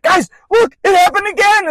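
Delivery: high, loud, breathless excitement; showing friends the phone, way up high and loud; freaking out. Retold or imitated. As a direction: squeal it to the table, can't believe it. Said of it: "I'm, like, freaking out".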